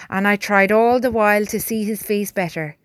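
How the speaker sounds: usual speaking voice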